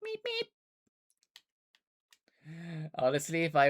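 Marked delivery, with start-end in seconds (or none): 0.0-0.5 very high squeaky meep meep. Shown as a demonstration. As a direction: squeak it like a cartoon bird. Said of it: "The kids do noise".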